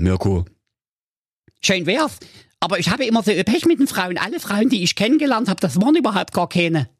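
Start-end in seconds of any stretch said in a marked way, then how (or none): 1.6-6.9 höhere, schnelle, weinerliche Nerdstimme; jammernder Nerd. Acted. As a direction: verpeilter Computernerd klagt über Frauen. Mirko klagt. (Mirko klagt dem König sein Liebespech)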